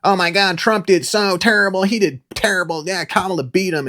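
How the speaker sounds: high, whiny mock of the chat; mocking chat commenters. Mocking Crowder's anti-Trump chat in a high whiny voice. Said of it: "That's where, like, all of 'em were".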